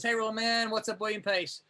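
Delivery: normal talking voice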